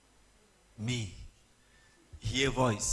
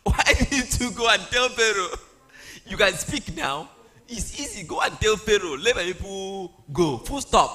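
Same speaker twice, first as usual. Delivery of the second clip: higher, louder, exasperated teasing lecture; mock scolding; 5 semitones higher, 7 dB louder than usual. Preacher mock-scolds Moses for his excuses, raised and higher. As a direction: lay into him with playful exasperation.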